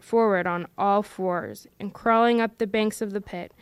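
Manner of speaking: ordinary delivery, nothing marked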